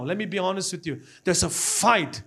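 ordinary talk

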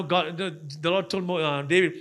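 ordinary talk, nothing marked

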